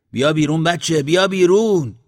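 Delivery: loud, high long-distance call; calling through cupped hands. A narrator's reading. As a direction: holler to the child, climbing on the repeat. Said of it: "دستهایش را گرفت دور دهان و موسرخه را صدا". (صدا زدن بلند از دور)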